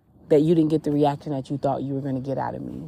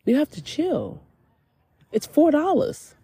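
high-pitched put-on voice, talking someone down; imitated incredulous calming voice, pitch way up and drawn out; God's exasperated reply; 11 semitones higher than usual. God talking her down over a shirt, high incredulous voice.

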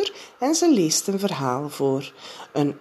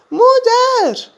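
put-on high, loud child's cry for mother; calling out; 11 dB louder than usual. A narrator's reading. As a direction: call out for mother in the dark. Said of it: "riep Jip". (Jip roept 's nachts luid en hoog om moeder)

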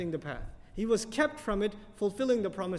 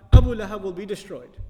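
Abu Lahab will be destroyed, much louder, hitting Abu; loud declaration; 13 dB louder than usual. Real, not acted. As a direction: boom out the promise. Announcing the Quran's promise.

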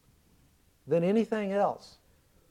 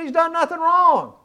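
Pilate pleading; 10 semitones higher, 5 dB louder than usual. Retold or imitated. Pilate defends Jesus to crowd.

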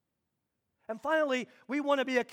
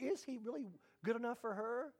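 inner thought; 14 dB quieter than usual. Retold or imitated. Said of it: "you think".

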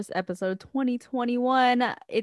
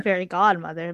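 ordinary talk, nothing marked

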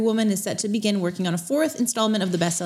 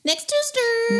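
high squeaky outro; 14 semitones higher, slower than usual. Real, not acted. Sign-off.

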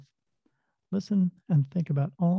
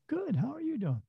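soft chirpy reply; 10 semitones higher, 7 dB quieter than usual. Retold or imitated. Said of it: "acts out stranger's reply".

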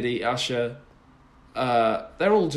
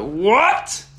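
stunned; 7 semitones higher, 5 dB louder than usual. Retold or imitated. Stunned by [name].

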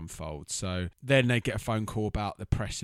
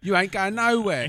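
loud, high imitation; hammed-up high, loud order; mock bossy refusal; 10 semitones higher, 8 dB louder than usual. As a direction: belt it out like an overacting wife. Echoing the overacted Denise line.

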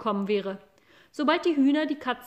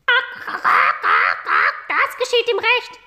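very high, loud clucking hen voices; cackling hens; 11 semitones higher, 9 dB louder than usual. Acted. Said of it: "riefen die Hühner".

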